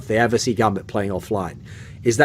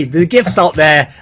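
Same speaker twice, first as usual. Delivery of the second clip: usual speaking voice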